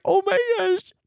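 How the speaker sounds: high crying oh my gosh; overjoyed sob, pitched high; retold sob. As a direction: cry it out, voice breaking high. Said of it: "I was just like".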